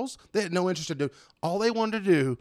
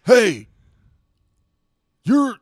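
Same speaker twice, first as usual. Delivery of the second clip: mock confrontation, louder and higher; retold loud challenge; 5 semitones higher, 6 dB louder, much slower than usual. Bar stranger challenging a faked local, loud retold challenge. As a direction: call out the faker, halting then emphatic.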